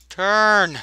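shouted order. Acted. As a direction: bark the order again. Repeating order to the crew.

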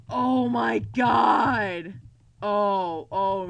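loud, very slow cry of horror; horrified shout. Real, not acted. Player realizing they all died, horrified loud cry. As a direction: draw it out loudly in shock.

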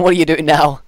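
ordinary talk, nothing marked

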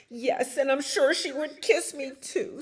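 high, loud eager chick; little bird voice. A narrator's reading. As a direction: pipe up hopefully as a baby bird. Hopeful chick's voice in the story.

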